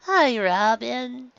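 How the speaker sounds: high, slow, dopey giggling; loopy under sedation, giggly and high; high sing-song drugged voice; drugged giggly Luffy. Acted. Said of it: "Luffy turned to her and giggled".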